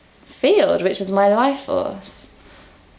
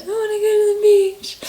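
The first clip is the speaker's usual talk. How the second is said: put-on much higher, fast little-girl voice; childish whine; 14 semitones higher, 3 dB louder, faster than usual. Retelling her childhood self crying for the beach, high childish whine. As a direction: whine it like a sulky small child. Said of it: "probably from childhood".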